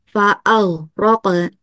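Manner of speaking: measured, drawn-out recitation; Quran recitation. Shown as a demonstration. Teacher starting an example verse, measured recitation. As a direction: recite syllable by syllable as a demonstration. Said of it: "dengan gunah sangat sempurna".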